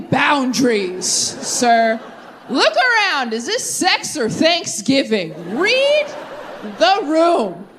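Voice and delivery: high, slow mock scolding. Telling off a partner in bed, high mock scolding. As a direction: lecture him like a prim hostess. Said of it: "I'd be like".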